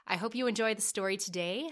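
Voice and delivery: ordinary delivery, nothing marked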